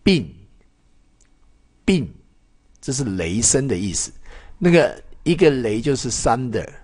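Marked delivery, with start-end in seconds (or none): 0.0-2.1 并 said alone, short and falling, with a pause between takes; model pronunciation. Shown as a demonstration. Teacher answering how to read it, modeling 并. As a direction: give 并 twice as a clean fourth-tone model. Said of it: "怎么念？".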